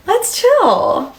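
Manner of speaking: very high, squeaky mock plea; high-pitched playful plea. Streamer telling nosy viewers to relax, squeaky mock plea. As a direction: repeat it way up high, jokingly.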